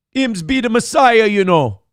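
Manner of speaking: playful put-on Jamaican reading; Jamaican patois. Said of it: "This is in patois".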